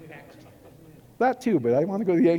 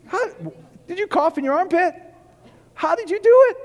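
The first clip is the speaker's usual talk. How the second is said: much higher and louder, pressing the question; excited challenge; 10 semitones higher, 3 dB louder than usual. Real, not acted. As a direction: throw the question at them, worked up. Rhetorical challenge to the congregation.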